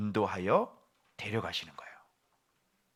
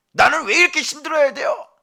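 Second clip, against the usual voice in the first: much higher and louder, an aching plea; pleading cry; 10 dB louder than usual. Acted. Sufferer asking the Lord why, loud pleading cry. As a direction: cry out the complaint. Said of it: "하는데 그 질문을 들으면".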